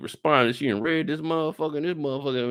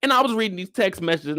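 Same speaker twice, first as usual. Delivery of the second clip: ordinary talk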